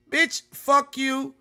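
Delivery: slow drawn-out mock insult at a boss; mock cussing out. Cussing out a fan's boss on their behalf, slow mock insult. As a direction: stretch it out like you're telling off their boss. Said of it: "if you need me to cuss out your boss".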